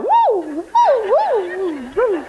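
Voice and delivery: high hammed-up howling woos; a silly high dog howl; mock dog howl. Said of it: "barking like dogs".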